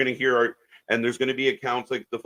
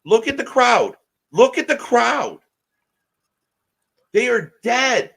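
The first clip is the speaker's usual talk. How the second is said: much higher and louder, pointing it out; exasperated shout; 10 semitones higher, 6 dB louder than usual. Real, not acted. Ranting to his co-host about the silent arena, shouting. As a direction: yell it at the screen in disbelief.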